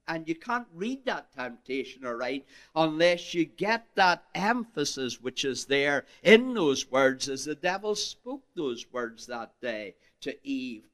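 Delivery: usual speaking voice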